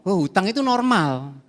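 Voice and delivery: mocking the world. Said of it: "Dunia itu kan ngomong".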